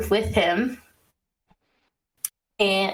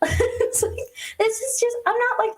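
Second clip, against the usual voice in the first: laughing; 13 semitones higher than usual. Real, not acted. Laughing through her complaint.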